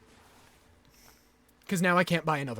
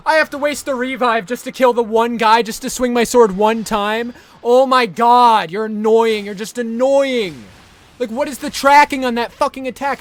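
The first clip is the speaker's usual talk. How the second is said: loud, heated complaint; angry rant; 5 semitones higher, 13 dB louder than usual. Real, not acted. Gamer raging at the boss over a wasted revive, loud and angry. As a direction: vent the fury at the game.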